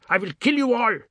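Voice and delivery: put-on a boy's screaming threat at intruders; screamed threat. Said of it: "and screamed".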